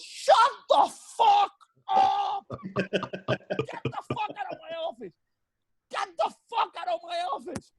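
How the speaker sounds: captain screaming at the sailor to get out; furious shout. Retold or imitated. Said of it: "the captain screaming at this guy".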